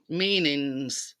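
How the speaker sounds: ordinary delivery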